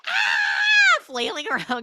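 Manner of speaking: high loud mock shriek; panicked yelp. Teacher caught gathering photos, mock panic shriek.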